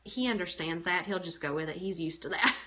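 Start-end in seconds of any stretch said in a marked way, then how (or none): none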